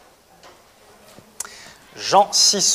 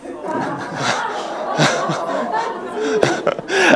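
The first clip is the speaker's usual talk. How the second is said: high burst of laughter; 12 semitones higher, 9 dB quieter than usual. Real, not acted. Laughs at own punchline.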